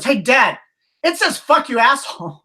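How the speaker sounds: high imitated child calling out; kid in back seat. Imitating his kid calling Dad from the back seat, childlike. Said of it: "one of my kids from the back seat goes".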